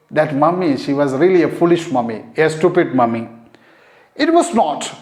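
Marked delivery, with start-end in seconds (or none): 4.1-4.9 "It was not" pitched up, firmly stressed; emphatic denial. Acted. Child angrily defending his mother, emphatic denial. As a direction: stress "It was not", starting high and falling. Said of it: "He said with a rare emphasis".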